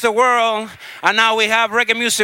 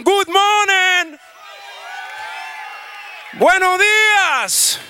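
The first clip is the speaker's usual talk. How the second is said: drawn-out crowd greeting; 7 semitones higher, much slower than usual. Real, not acted.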